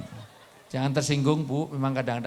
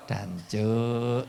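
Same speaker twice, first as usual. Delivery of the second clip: casual East Javanese curse; 5 semitones lower than usual. Retold or imitated. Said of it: "Nggak ada orang marah kok".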